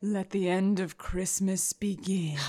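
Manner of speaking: soft sinister whisper; villainous whisper. Acted. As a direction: breathe it out like a curse.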